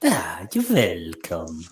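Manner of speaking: put-on low, slow, creaky old man; creepy old man voice. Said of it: "you had the creepy old man voice".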